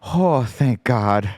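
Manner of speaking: put-on slow, relieved Midwest kid accent; drawn-out Midwest kid relief. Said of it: "This little kid in his Midwest accent goes".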